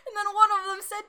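normal talking voice

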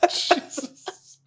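high, breaking through laughter; can't stop laughing. Real, not acted. Still laughing at the joke.

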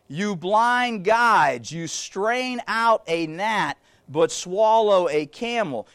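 drawn-out, measured words; preacher slowly reading the rebuke; slow solemn reading. Acted. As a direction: let each phrase land slowly.

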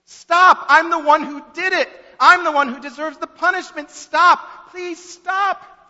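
high, shouted, desperate begging; anguished sobbing plea. A narrator's reading. Guilty boy begging them to stop punishing the principal, anguished. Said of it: "the boy sobs".

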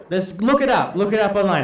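ordinary delivery

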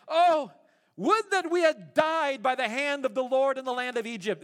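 grumbling crowd, playing it Shakespearean; melodramatic lament. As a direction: ham up the tragic lament. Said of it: "they said to them".

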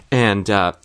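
ordinary delivery

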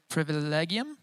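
normal talking voice

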